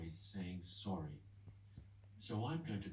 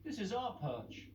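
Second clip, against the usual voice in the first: high, slow grumbling pigeon; slow territorial grumble; scruffy pigeon claiming its perch; grumbled; 10 semitones higher, 5 dB louder than usual. Acted. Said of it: "they grumbled".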